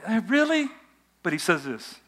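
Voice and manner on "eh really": doubtful Simon. Acted. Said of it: "Simon's going".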